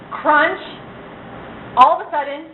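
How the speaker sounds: usual speaking voice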